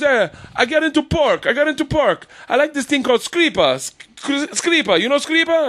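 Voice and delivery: high, excitable Italian husband impression; hammed-up Italian-accented storyteller. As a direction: do a comic Italian accent, pitched well up. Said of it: "Her husband's an Italian guy".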